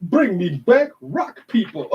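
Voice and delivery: hammed-up high villain line; mocking villain voice. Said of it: "That just sounds so lame".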